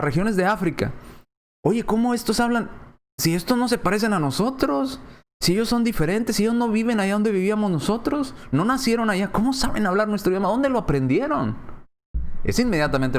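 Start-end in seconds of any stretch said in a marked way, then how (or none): 1.6-11.6 pitched-up disbelieving questions; amazed questioning. Acted. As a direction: ask it like you can't believe your ears. Voices amazed hearers of Africans.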